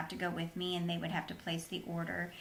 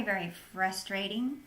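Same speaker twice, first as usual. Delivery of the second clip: ordinary talk, nothing marked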